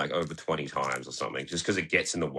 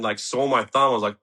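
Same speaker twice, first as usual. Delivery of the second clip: ordinary talk, nothing marked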